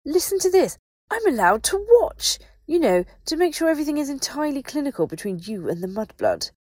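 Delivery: very high, loud outburst; near hysteria. A narrator's reading. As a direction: shriek it in hysterical outrage. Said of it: "Astoria looked so nearly hysterical".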